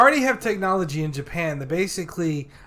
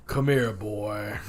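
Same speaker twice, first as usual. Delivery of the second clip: low, slow seductive growl; creepy come-on; 9 semitones lower, 6 dB quieter, slower than usual. Pretend. Acting creepy lover quote.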